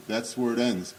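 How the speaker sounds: normal talking voice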